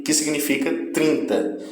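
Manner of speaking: normal talking voice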